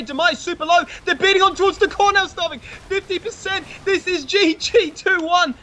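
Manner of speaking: high, building hype call; live play-by-play. Real, not acted. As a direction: call the winning push. Game-ending push play-by-play.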